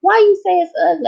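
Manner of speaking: high squeaky fast mock doctor; gynecologist voice. Gynecologist asking the patient why, high quick squeak. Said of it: "And she's like".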